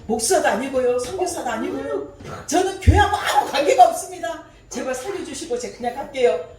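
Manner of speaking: retelling begging a North Korean official, high and loud; mock frantic pleading. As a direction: beg your way out, voice climbing.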